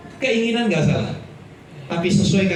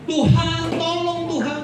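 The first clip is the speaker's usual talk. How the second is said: mock desperate prayer; 10 semitones higher, slower than usual. Mocking a begging prayer.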